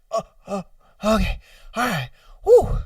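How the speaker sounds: puffing through an itch, hammed-up; mock discomfort. Acting out itchy person in bathroom.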